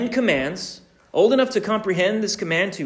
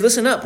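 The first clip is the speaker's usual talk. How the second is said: higher, louder attention call; sharp call; 6 semitones higher, 4 dB louder, much faster than usual. Shown as a demonstration. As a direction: call it out to get a child's attention. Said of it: "we use the phrase".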